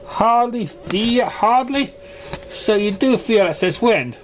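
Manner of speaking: high, slow, disbelieving questions; alarmed doctor pressing the point; voice rising. A narrator's reading. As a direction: pitch up in shocked disbelief. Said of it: "her voice raising a little bit".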